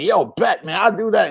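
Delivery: lightly voiced cocky guy, pitched high; cocky acceptance. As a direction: take the bet with a swagger.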